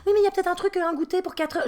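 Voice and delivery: put-on a young daughter begging her mum to come along; pleading little girl. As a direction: beg like a small child. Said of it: "La Camille fait".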